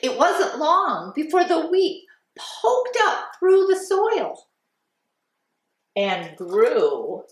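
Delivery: lilting, sing-song storytime voice; reading to children. Acted. Teacher reading The Little Red Hen to class, storytime voice. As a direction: read it like a kindergarten teacher.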